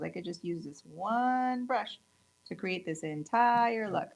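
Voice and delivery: ordinary delivery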